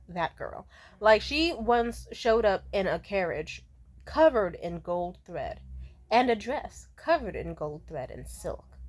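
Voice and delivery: ordinary talk, nothing marked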